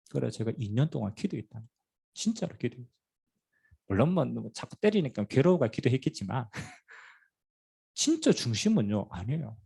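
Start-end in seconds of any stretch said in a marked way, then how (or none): none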